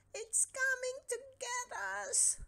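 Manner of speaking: very high panicked wail; terrified cry. Acted. Terrified cat begs his friend, very high panic. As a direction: plead in terror.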